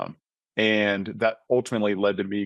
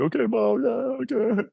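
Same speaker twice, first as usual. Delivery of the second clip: very high, strained voice breaking; high-pitched tearful agreement; choked-up okay. Retold or imitated. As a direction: squeak it out as if holding back tears.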